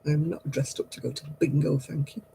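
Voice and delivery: quiet, dry put-down; real, dry dismissal of the bingo jibe; dry retort. Real, not acted.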